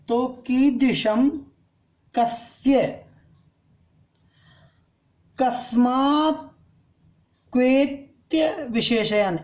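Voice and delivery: कीदृशम, कस्य, कस्मात् spoken slowly, each word set apart; slow recitation. Shown as a demonstration. Teacher modelling pure pronunciation, slow recitation. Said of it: "अपन शुद्ध उच्चारण करना सीखते हैं".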